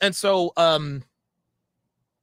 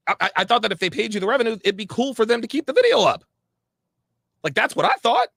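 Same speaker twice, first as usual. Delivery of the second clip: higher-pitched retold protest, climbing toward the end; exasperated protest; 5 semitones higher, 4 dB louder, faster than usual. Broker defending himself to his friend, rising exasperated protest. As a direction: build to an insistent, baffled finish.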